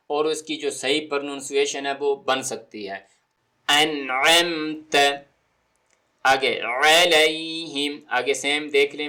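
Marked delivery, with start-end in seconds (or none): 3.7-8.0 drawn-out recitation; slow recitation. Shown as a demonstration. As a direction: recite slowly, clear ain. Recites verse.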